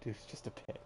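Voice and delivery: hushed throwaway remark; whispered aside. Real, not acted. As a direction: whisper it off to the side. Aside, unvoiced.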